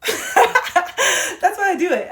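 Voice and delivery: high, loud laughing delivery. Real, not acted. Explaining why she does voices, laughing. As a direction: burst out laughing, carry it into the words.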